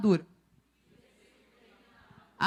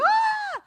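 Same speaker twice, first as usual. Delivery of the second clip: high-pitched imitated cry of amazement; crowd's gasping cry. Imitating the shocked congregation, high cry. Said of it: "começou a fazer barulho".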